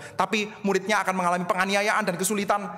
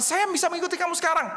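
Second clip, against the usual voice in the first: much higher, confident claim; Peter boasting; 9 semitones higher than usual. Acted. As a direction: boast that you can follow now.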